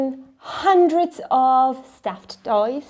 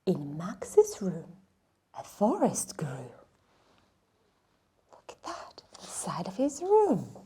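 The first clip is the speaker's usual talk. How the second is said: whisper; 5 semitones lower, 6 dB quieter than usual. Acted. Storyteller whispering as a forest grows in Max's room. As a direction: let the wonder creep in, barely breathing. Magical forest growing, hushed.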